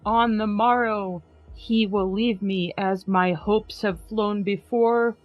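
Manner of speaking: ordinary delivery, nothing marked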